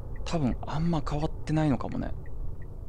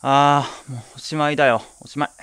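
despairing cry; 3 semitones higher, 7 dB louder than usual. Acted. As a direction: cry out that it's hopeless, half in jest. Declares the forbidden word "the end. (大げさに嘆く叫び)

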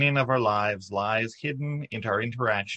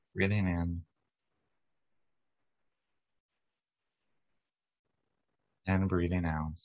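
guided meditation. Acted. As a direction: ease the congregation into calm, unhurried. Leads a guided body-light meditation.

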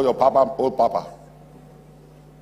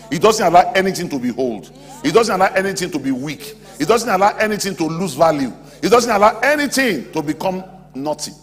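raised, loud, insistent refrain; high, forceful pulpit build; rousing preaching; 7 semitones higher, 8 dB louder than usual. Real, not acted. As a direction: hammer each repeated line home.

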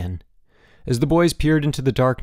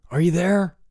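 raised, questioning call; 7 semitones higher, 3 dB louder than usual. A narrator's reading. Bruno checking Boots is still there, calling. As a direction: call up to find your friend. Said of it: "he called".

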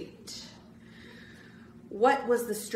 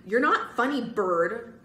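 ordinary delivery

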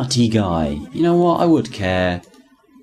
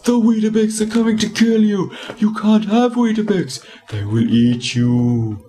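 high, frantic mock-paranoid warning; hammed-up paranoid assistant, high and loud; paranoid rant; 12 semitones higher, 4 dB louder than usual. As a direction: ham up a panicked lunatic shouting warnings. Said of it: "He must be, like, so paranoid".

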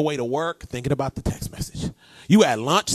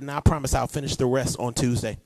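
ordinary talk

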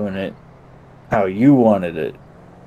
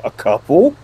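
higher and louder, disbelieving; incredulous cop; 7 semitones higher, 7 dB louder than usual. Retold or imitated. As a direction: retell the cop's shocked question. Cop incredulous at drink count.

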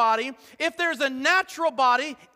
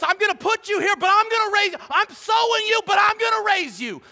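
higher, louder preaching of God's promise; passionate proclamation; 9 semitones higher, 5 dB louder than usual. Acted. Pastor voicing God to congregation, fervent proclamation. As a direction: ring it out with conviction.

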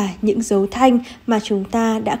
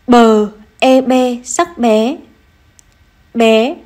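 slow spelling then reading; slower than usual. Shown as a demonstration. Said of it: "cô có từ bé".

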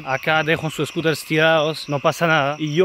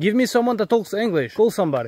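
high-pitched, insistent phone plea; distressed and demanding; urgent demand; 7 semitones higher than usual. Real, not acted. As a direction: press the listener to get help. Demands an English speaker on phone.

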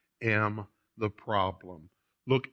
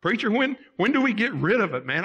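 higher, louder, hurried plea to the preacher; pleading questioner; 9 semitones higher, 5 dB louder, faster than usual. Retold or imitated. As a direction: imitate an exasperated churchgoer asking when. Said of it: "Someone asked me one time".

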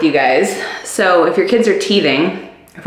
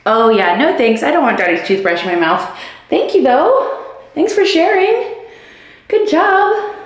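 voicing the baby; 10 semitones higher than usual. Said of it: "So she can imitate".

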